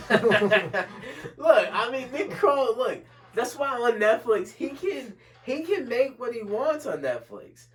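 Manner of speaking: high and loud through a laugh; giddy laughing rant; laughing and fired up. Real, not acted. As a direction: laugh it off and keep pushing the point. Bursts out laughing then argues.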